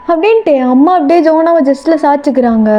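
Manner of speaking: ordinary delivery, nothing marked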